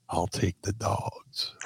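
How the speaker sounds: slow, soft unvoiced whisper in the ear. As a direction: lean in and whisper it slyly. Voice in his ear, sneaking up.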